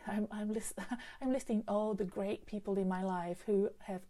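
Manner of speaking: normal talking voice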